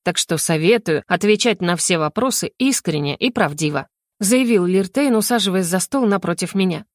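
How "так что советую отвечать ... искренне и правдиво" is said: ordinary delivery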